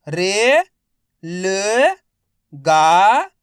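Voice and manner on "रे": ordinary delivery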